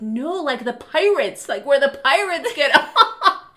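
imitated kid protest breaking into laughter; protesting little kid. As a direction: insist like a child, then crack up. Acting protesting child, cracking up.

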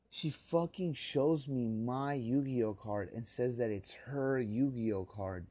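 loud, high, slow seething rant; bitter furious tirade, dragging the words; real rage. Real, not acted. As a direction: spit out each insult with real fury. Furious rant about stolen card.

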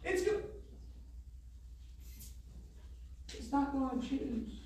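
high, loud, slow preaching; high loud slow pulpit climax; preaching at full force. Acted. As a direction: drive each line home. Sermon climax.

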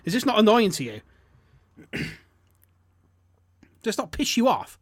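high, loud, indignant questioning; exasperated rant. Real, not acted. Podcaster ranting to co-host about an advert, exasperated. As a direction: demand that they share your annoyance.

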